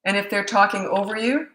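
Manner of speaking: ordinary delivery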